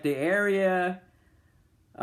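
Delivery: normal talking voice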